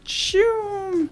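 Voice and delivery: high whooshing mouth sound; high swoosh effect. Real, not acted. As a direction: do a quick whoosh.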